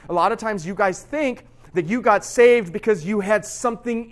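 loud, high, impassioned preaching; full-force preaching. Acted. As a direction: preach it hard to the youth group, slowing on key words.

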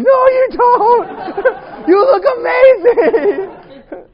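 high, loud, slow words laughed out; stretched squealing laugh of delight; laughing gush. Retold or imitated. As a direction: gush through laughter that she looks amazing. Gushing over her new look.

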